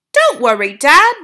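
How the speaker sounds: put-on boy turned mouse popping out of the handbag; cheeky mouse-boy. A narrator's reading. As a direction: chirp it cheekily. Bruno to his father.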